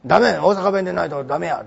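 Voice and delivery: lightly voiced Osaka dialect reprimand; a hint of a backstage elder scolding in Osaka dialect; mock Osaka dialect telling off. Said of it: "大阪弁以外喋ると、あの、怒られちゃうらしいです".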